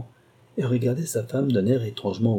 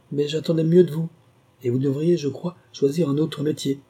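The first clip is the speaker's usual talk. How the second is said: taunt; 6 semitones higher than usual. Acted. Woman taunting the reluctant thief.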